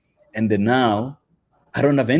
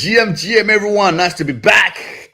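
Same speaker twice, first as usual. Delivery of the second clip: high, loud excited hello; hyped greeting; 8 semitones higher, 3 dB louder than usual. Retold or imitated. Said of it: "Godwin says".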